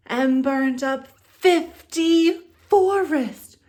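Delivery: dragon roar. Acted. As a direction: roar like a fire-breathing dragon. Dragon breathes fire in story.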